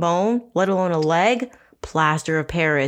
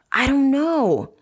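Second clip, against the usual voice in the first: unsure; 7 semitones higher, slower than usual. Retold or imitated. Liz unsure, voiced.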